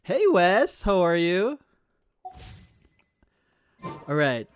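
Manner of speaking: ordinary talk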